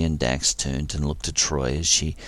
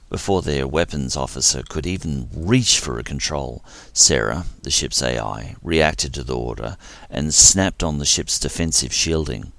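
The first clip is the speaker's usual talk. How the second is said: ordinary delivery, nothing marked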